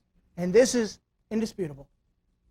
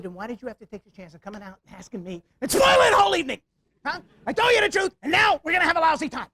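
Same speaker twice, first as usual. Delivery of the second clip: exasperated scolding; 4 semitones higher, 5 dB louder than usual. Retold or imitated. Scolding wife after party upset.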